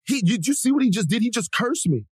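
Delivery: high-pitched aggrieved complaint; mock victim outrage. Gym guy complaining to staff about being cursed at, mock victim outrage. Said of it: "right into victim mode".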